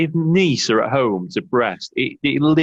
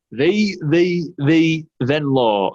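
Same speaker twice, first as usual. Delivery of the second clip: slow, drawn-out Yorkshire voice; Yorkshire accent; 6 semitones higher, much slower than usual. Retold or imitated. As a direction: do Darren's Yorkshire twang. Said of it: "in his Yorkshire accent".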